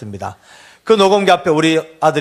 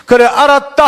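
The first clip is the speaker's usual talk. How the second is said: anguished cry; 9 semitones higher, 6 dB louder than usual. Retold or imitated. Father answers son at the hanging.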